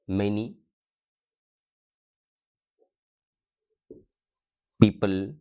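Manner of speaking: slow, broken-up reading of an English sentence; word-by-word dictation. Real, not acted. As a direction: read it out piece by piece, with long pauses. Writes example, reading it word by word. (धीरे-धीरे, एक-एक शब्द रुककर बोलते हुए)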